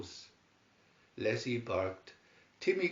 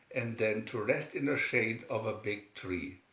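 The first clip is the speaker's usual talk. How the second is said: ordinary delivery, nothing marked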